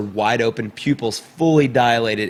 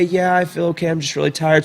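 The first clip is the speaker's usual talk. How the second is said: retold child; 6 semitones higher than usual. Daughter's answer voiced in story.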